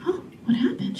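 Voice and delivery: whispered, mock-worried question; hushed mock concern. Mother asking the daycare lady in a mock-worried whisper. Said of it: "I said".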